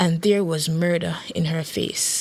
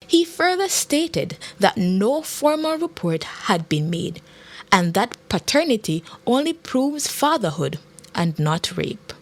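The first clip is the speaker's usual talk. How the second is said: plain reading voice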